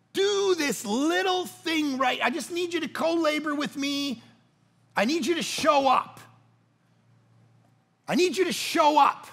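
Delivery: high, insistent appeal; impassioned preaching plea. Acted. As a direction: plead with the congregation, pitched well up. Preacher voices God's plea to congregation.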